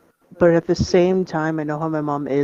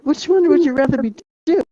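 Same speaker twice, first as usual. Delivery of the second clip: incredulous rant; 10 semitones higher than usual. Retold or imitated.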